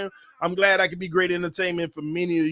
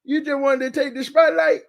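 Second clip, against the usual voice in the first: high-pitched playful parent; 7 semitones higher than usual. Real, not acted. Teasing baby in baby talk.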